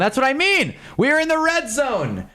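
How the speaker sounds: rally like a coach in the red zone; hyped. Real, not acted. Streamer to chat about his date, hyped coach talk.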